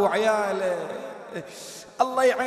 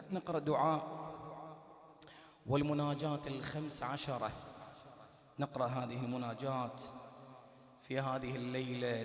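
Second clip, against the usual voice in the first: lower, much softer devotional voice; soft prayer guidance; 7 semitones lower, 11 dB quieter than usual. Acted. Preacher guiding mourners into prostration, speaking low and soft. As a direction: ease the room gently into prayer.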